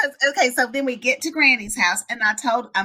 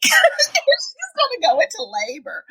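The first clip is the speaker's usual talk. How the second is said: high, squealing through laughter; laughing; 5 dB louder than usual. Real, not acted. Mom revealing her story's labor twist, laughing.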